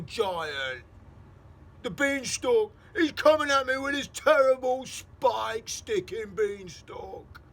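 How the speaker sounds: slow, loud, high desperate begging; pleading giant. Acted. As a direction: beg for mercy in terror.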